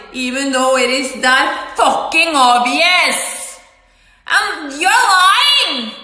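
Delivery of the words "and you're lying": high, drawn-out accusation; raised angry voice. Retold or imitated. Speaker accuses the other person of lying, raised angry voice. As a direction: accuse them with pitch pushed way up. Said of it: "I'm using a loud voice".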